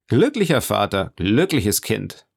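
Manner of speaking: usual speaking voice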